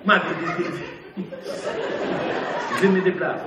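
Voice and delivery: lightly voiced Italian painter, low; hint of an Italian accent, dropping low. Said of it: "il est italien".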